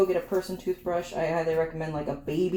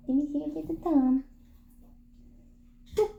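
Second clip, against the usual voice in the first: much higher cooing voice; pet talk; 9 semitones higher than usual. Real, not acted. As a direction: sweet-talk the dog as you check. Talking sweetly to the dog.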